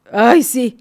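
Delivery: laughing reply. Retold or imitated. Daughter laughing as she answers her mother, loud amused reply. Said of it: "se ríe y me dice".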